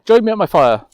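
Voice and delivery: bright, rising welcome at the campfire; a friendly minstrel hailing a stranger; cheery troubadour. Troubadour welcoming a traveller to his fire, cheery.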